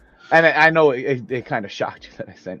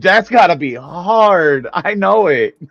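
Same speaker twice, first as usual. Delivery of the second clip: emphatic; 6 semitones higher, 8 dB louder, slower than usual. Real, not acted. Sympathizing loudly.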